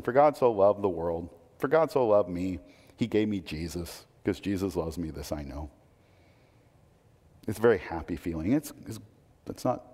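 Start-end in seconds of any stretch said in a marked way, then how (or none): 0.0-5.7 soft, much lower, sweetly content recital; a gentle, self-satisfied take on the verse; sentimental reading. Shown as a demonstration. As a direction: read it like a cosy children's verse. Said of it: "This is how we read John three sixteen".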